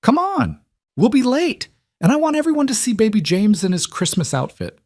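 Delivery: put-on very high-pitched, excited little girl; excited child. A narrator's reading. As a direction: hurry the grown-ups along in a high, eager child's voice. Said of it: "her face bright with excitement".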